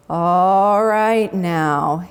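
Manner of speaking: slow, low lazy drawl. Acted. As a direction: drag the words out lazily. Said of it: "he would drawl".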